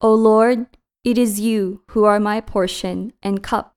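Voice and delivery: ordinary talk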